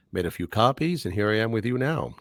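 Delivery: ordinary talk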